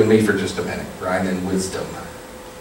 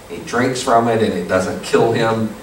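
usual speaking voice

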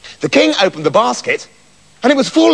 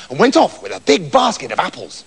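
ordinary delivery, nothing marked

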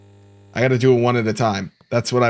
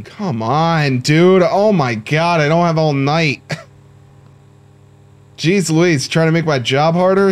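raised, pushy mock exasperation; exasperated; 4 semitones higher, 4 dB louder than usual. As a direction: complain up high, hurrying him along. Exasperated at dawdling game customer.

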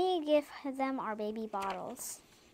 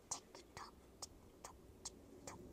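whispered, voiceless tick-tock; clock ticking; 20 dB quieter, much faster than usual. A narrator's reading. Child reading baby's clock sound, whispered ticking. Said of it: "That's the sound of baby's clock".

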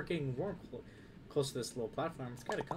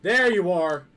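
big, higher-pitched shout; loud exclamation; 5 semitones higher, 16 dB louder, much slower than usual. Real, not acted. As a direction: call out at full volume. Spots what they were looking for.

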